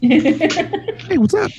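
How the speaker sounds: high, bright imitation of a text; lightly mocking friendly hello; mock cheerful greeting. Imitating her friendly message.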